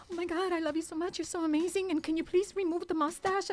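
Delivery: high, sweet-talking begging; imitated fan gush turning to pleading; retold gushing plea. Retells gushing plea to director.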